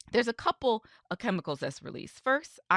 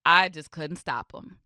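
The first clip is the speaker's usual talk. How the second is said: ordinary talk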